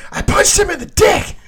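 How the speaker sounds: high, loud mock yell; wife's catchphrase, yelled high and loud; mock shout. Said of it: "her expression".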